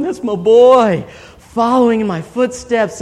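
swell-headed dad crowing, hammed up; proud father. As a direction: imitate a dad puffed up with pride. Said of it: "his head's getting big".

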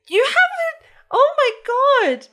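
put-on high, loud awestruck kid; a boy stunned by a picture; kid losing his mind. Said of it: "He's like".

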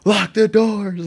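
high, loud, drawn-out mock holler; yelling. Storyteller relives warning the house, high panicked yell. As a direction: imitate calling out slowly to someone far off. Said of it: "I'm yelling to the house".

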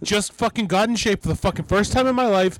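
hammed-up angry wrestler rant; mock rant. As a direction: vent like a bitter veteran. Voicing angry Nova.

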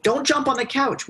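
high, sharp mock parent telling a child off; scolding parent. Imitating a parent forbidding a kid the couch, high mock scold.

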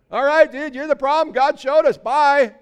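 loud, high dismissal; hammed-up shouted farewell; comic brisk send-off. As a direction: holler a blunt goodbye as you throw him out. Sailors toss Jonah overboard.

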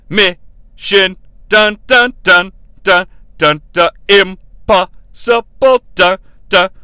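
dramatic trailer title drop, word by word; staccato. Retold or imitated. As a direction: beat out each word like a trailer hit. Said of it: "they reveal the title one word at a time".

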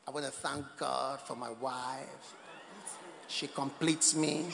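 low, slow speech to the party; mock husband's tribute, drawn out; mock devoted husband. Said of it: "Then when the party comes, they say".

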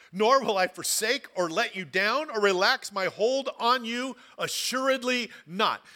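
loud, rising preacher's reading of scripture; preaching scripture. Acted. Pastor proclaiming God's promise to the congregation, rising and loud. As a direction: proclaim the promise with full force.

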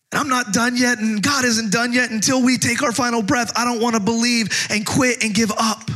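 ordinary talk, nothing marked

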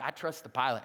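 low, soft and steady; calm assurance. Retold or imitated. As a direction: settle everyone down. Calm during turbulence.

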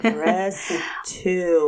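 slow, monotone phone menu voice; monotone recording voice. Retold or imitated. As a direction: drone it like an automated menu. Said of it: "monotone like this".

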